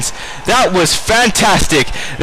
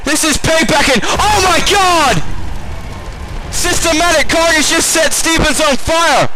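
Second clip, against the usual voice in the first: much higher, panicked play-by-play; shocked commentator; 9 semitones higher than usual. Real, not acted. Commentator watching Carnage attack Stevens, shocked and much higher. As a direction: yell the ambush in disbelief.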